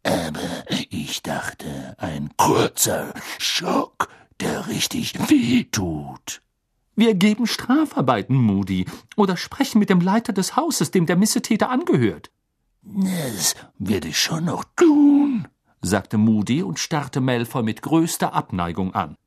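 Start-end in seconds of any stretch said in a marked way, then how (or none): 0.0-6.4 low, very slow character voice; deep, laboured old-teacher drawl; gruff Moody, unrepentant. Acted. 7.0-12.3 high-pitched, insistent lecture; exasperated stern professor; indignant scolding. Acted. Professor lectures Moody on rules. 12.9-15.5 slow, drawn-out character voice; ominous promise, very unhurried; grudging Moody. Acted. Moody answers while glaring.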